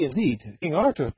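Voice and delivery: normal talking voice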